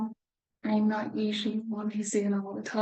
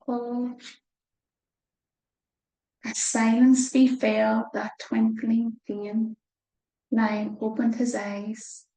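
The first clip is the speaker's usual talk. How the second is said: usual speaking voice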